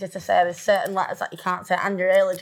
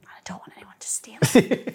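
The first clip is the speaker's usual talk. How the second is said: whisper. Real, not acted. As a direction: whisper it like a secret. Secretive aside about baby names.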